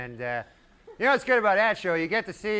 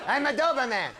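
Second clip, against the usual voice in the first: has-been Doberman; 8 semitones higher, faster than usual. Acted.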